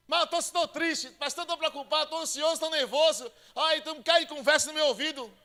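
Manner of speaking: high, loud anxious whining; anxious believer. Retold or imitated. Anxious believer pouring worries out to the pastor. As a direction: list the worries in a fretful believer voice.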